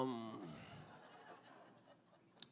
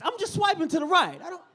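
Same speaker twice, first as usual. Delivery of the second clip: high defensive excuse; 10 dB louder than usual. Pretend. Repeat excuse.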